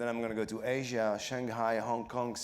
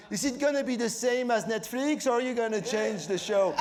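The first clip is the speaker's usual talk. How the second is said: French fans asking; 10 semitones higher, 6 dB louder than usual. Retold or imitated. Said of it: "only French people say".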